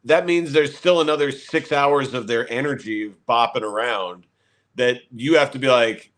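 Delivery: ordinary delivery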